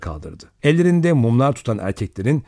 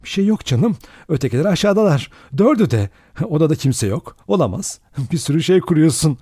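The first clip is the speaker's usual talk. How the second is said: higher-pitched, urgent self-talk; self-reassuring; 6 semitones higher than usual. A narrator's reading. Vera giving herself courage in the dark, anxious reassurance. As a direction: talk yourself out of fear. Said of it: "diye kendine güç verdi".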